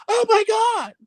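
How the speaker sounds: very high mocking girlish cry; little girl. Teasing friend's account of the wife running in, high little-girl squeal. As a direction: imitate a frightened little girl. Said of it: "ran in the room like a little girl".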